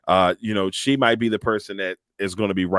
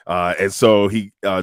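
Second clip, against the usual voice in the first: ordinary talk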